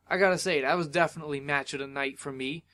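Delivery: ordinary delivery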